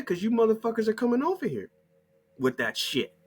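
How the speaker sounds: ordinary delivery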